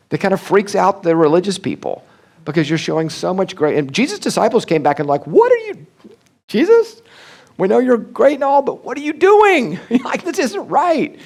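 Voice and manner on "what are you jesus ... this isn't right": high, loud incredulous objection; mock disciples' protest. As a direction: play the shocked disciples.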